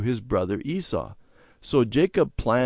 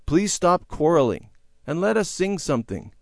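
higher-pitched fatherly appeal; raised, coaxing request; calling out; 8 semitones higher than usual. A narrator's reading. Father asking quarreling children to sing, calling out. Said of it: "Father called".

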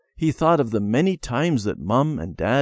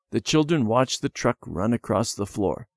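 ordinary delivery, nothing marked

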